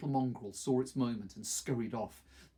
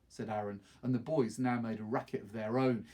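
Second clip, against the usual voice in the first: ordinary delivery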